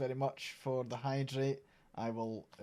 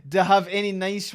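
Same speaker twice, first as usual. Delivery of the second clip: high, loud laughter into a question; laughing; 7 semitones higher, 12 dB louder than usual. Real, not acted. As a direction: laugh hard, then ask through it. Laughing after being teased.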